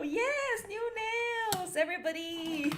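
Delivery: high, bright announcing of the nails; excited show-off to the viewers. Real, not acted. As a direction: present them like a prize. Showing off new nails.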